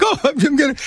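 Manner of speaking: high-pitched imitation of a tearful kid; mock crying. Eight-year-old running punishment laps, mock crying. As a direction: pretend to wail like a child. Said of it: "I'm crying like".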